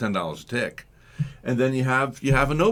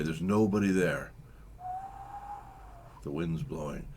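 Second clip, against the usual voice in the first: normal talking voice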